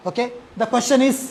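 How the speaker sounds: normal talking voice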